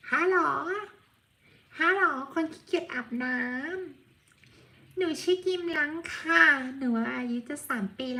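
put-on high, childlike voice speaking as the cat; cute cat voice. Owner speaking as her cat to viewers, high cute voice. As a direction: play the lazy pet cat talking to viewers.

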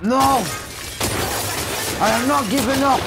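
high, loud, insistent yell; real heated defiance; defiant shouting. Real, not acted. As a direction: shout your refusal in the heat of play. Refusing to quit during game fight.

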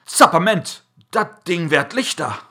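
loud, high cry of surprise in dialect; astonished farmer. A narrator's reading. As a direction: blurt it out as the sack gets lighter. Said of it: "verwundert steht und spricht er".

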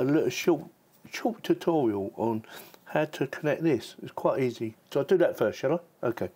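ordinary talk

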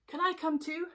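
a hint of a hopeful little mermaid; lightly voiced mermaid. Mermaid asks to join the trip, lightly pleading.

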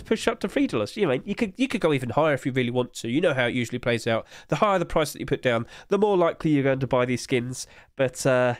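ordinary talk, nothing marked

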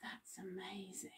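whispered awe, barely voiced; amazed whisper. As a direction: breathe it out in amazement. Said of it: "and we will say".

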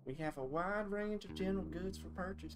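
put-on light country shopkeeper accent; a hint of country store clerk. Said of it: "I'm already doing a country accent".

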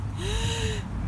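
big high inhale. Acted. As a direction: suck in a huge breath. Wolf inhales deep breath.

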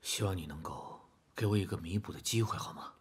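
soft, hushed, earnest plea; quiet apology. Acted. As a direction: apologize softly, nearly whispering. Father's hushed, earnest apology to son.

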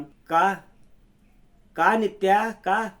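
pained outburst. Acted. Shalini's tearful outburst at Nitya.

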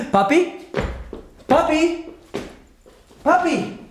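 high-pitched, questioning calls for daddy; calling for daddy. Acted. Acts out calling for absent dad.

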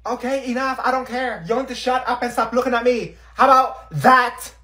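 high, loud, fed-up scolding; angry teacher. Acted. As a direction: snap at the class. Teacher snapping at class.

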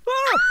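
sharp high startled cry; startled yelp. Acted. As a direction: jump with a sudden yelp. Startled by cockroach.

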